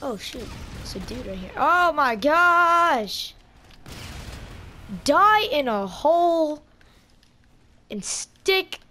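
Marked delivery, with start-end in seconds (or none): 1.6-8.8 drawn-out furious shout, much louder and higher; real yell. Real, not acted. Gamer yelling at an enemy player, slow furious shout.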